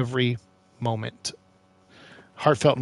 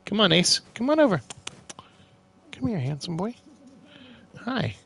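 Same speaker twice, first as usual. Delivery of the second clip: high, lilting pet talk, getting softer; coaxing a pet; 6 semitones higher, 4 dB quieter than usual. Real, not acted. Owner calling Ace over to say hi, coaxing. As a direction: call a pet over sweetly.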